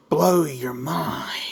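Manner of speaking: slow, hushed teaser whisper; dramatic whisper. Acted. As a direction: whisper it like a big secret. Teasing reveal again.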